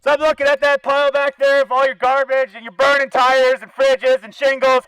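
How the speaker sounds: usual speaking voice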